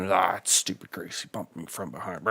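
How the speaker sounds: mock an annoyed driver muttering to himself. Streamer imitating a bumped rival driver, muttering. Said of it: "Just muttering".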